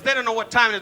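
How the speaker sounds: ordinary delivery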